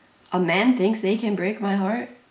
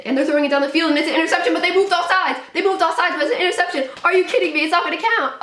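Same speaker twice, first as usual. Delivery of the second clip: high-pitched frantic play call; agitated disbelief; 9 semitones higher, much faster than usual. Real, not acted. Fan seeing an interception wiped out by offside, frantic. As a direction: voice jumps up and stays there in disbelief.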